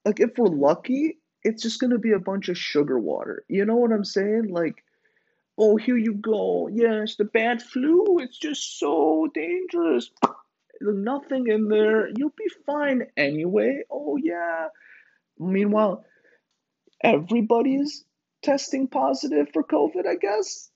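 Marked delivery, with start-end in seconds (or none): 5.6-14.7 high, breezy mock reassurance; dismissive nurse voice. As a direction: brush the patient off cheerfully. Voicing a dismissive vaccinator.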